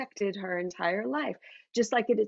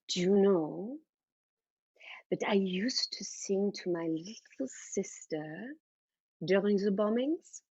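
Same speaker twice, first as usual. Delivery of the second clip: put-on gentle, halting German-accented storyteller; German mother reminiscing. Mother telling daughter of wartime bombings, gentle German-accented voice.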